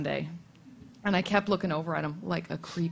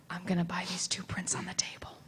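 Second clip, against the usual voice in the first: whispered, softer, giving up; defeated whisper; 7 dB quieter than usual. Retold or imitated. Starstruck fan buying prints, defeated whisper. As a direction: breathe it out, beaten and embarrassed. Gave up, mumbled purchase.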